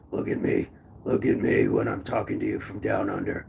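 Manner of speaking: very deep, soft put-on voice; playful low-voiced Aussie tough-guy act; put-on Down Under character. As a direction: drop way down low, hushed and mock-menacing. Playing up Australian chant, then apologizes.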